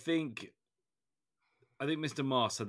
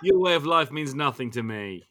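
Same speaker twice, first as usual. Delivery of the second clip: louder, laughing villain sneer; mustache twirling villain; 4 semitones higher, 9 dB louder than usual. Pretend. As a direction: gloat like a corporate hitman. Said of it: "the mustache twirling, almost villain".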